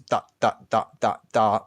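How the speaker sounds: short detached syllables with gaps; staccato. Shown as a demonstration. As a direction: clip each syllable apart. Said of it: "That's called staccato".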